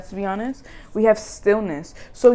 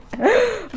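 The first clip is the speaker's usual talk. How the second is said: bright laugh, much higher; 14 semitones higher, 4 dB louder than usual. Real, not acted. Reader laughs at how worried she was. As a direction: laugh at her own panic. Laugh after retold panic.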